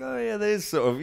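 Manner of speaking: mock surprised. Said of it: "And I was like".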